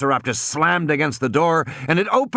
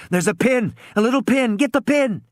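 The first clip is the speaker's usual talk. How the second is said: high, rising urgent commands; frantic instructions; urgent shouting; 12 semitones higher than usual. A narrator's reading. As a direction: press her to grab it now.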